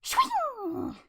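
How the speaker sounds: very high sharp sword swish; Pokemon cry. Acted. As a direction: slash the word like a blade.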